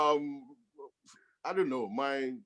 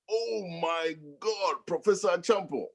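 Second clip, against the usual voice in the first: retold mock scream; 7 semitones higher than usual. Student spotting the professor at a club, mock scream. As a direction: imitate an astonished scream at restrained volume. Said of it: "and then somebody screams".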